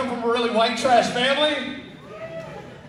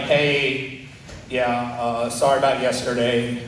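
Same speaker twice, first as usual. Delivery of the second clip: sheepish dad; 9 semitones lower than usual. Retold or imitated. Dad apologizing next day.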